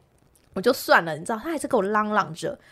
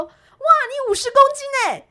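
high excited shout of a husband; husband yelling out; 5 dB louder, slower than usual. Retold or imitated. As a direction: blurt it out high and loud across the hall. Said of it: "我先生就在后面大叫说". (在天文馆里大声惊呼)

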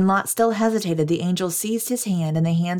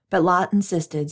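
plain reading voice

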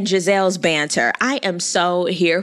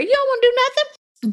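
high emphatic. Acted. Relaxing on vacation.

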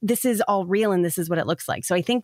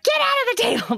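put-on much higher, squeaky little-kid order; imitating toddler's bossy demand. Little sister kicking her out from under the table, squeaky toddler voice. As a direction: squeak it out like a bossy two-year-old. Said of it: "was like".

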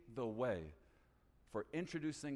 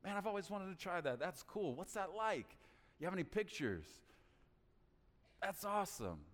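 engaged interest; 4 semitones higher than usual. Shown as a demonstration. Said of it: "excited about what they're passionate about".

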